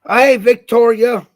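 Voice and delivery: loud, high, drawn-out hello to a viewer; big slow cheerful shout-out; excited greeting. Real, not acted. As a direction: call out happily to a friend who just joined. Greeting a viewer during live.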